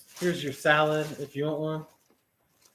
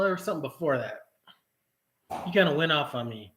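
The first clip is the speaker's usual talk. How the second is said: ordinary delivery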